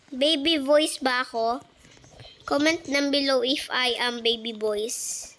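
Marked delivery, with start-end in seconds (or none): none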